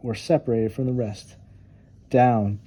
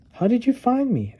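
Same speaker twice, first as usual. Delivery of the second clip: much higher, eager cub voice; 12 semitones higher than usual. Acted. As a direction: ask wide-eyed as the cub. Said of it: "asked Cub".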